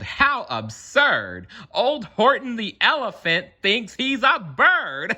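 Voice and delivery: high, mocking jeering voices; taunting yell. A narrator's reading. Friends yelling taunts at Horton, high and mocking. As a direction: yell it to make fun of him. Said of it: "they yelled".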